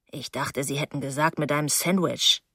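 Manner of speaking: low, very soft voice; hushed aside. Acted. Narrator's companion in the dark, hushed and low. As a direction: keep it down, pitched low.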